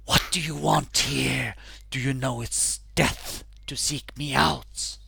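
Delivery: eerie ghost voice from beyond; menacing spectral warning; dark lord. Acted. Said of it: "She speaks to you as she appears".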